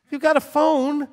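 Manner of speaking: high, loud, insistent telling-off; exasperated. Acted. Rabbi pushing helpless congregants to act, loud and high. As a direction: build to an exasperated shout.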